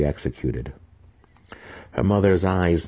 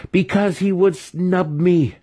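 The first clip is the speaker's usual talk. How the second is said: much higher, louder slow yell; 14 semitones higher, 5 dB louder, slower than usual. A narrator's reading. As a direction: shout out his grievance. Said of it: "Massage yelled".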